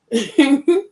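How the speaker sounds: a loud, high burst of laughter; loud high cackle. Real, not acted. Mocking a rival in hiding, loud laughter.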